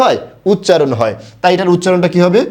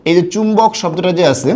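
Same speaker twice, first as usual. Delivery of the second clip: ordinary delivery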